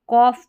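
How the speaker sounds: कॉफ leaned on, ending in a clear f. Shown as a demonstration. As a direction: stress कॉफ, "kawf" with final f. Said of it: "GH को आपको फ पढ़ना है".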